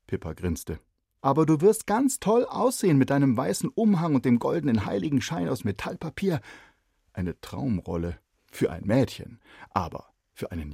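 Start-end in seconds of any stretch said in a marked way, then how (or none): 1.2-6.4 put-on high, loud girl's voice; a bright neighbour girl asking; lively girl. Neighbour girl asks shy boy why not, lively. Pippa's voice.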